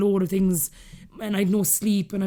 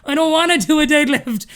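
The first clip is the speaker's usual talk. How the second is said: mock whine; 7 semitones higher, 6 dB louder than usual. Whining to her trainer.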